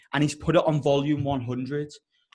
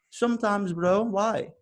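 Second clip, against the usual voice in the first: Russian taxi driver; 6 semitones higher, slower than usual. Acted. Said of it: "I've got this Russian taxi driver".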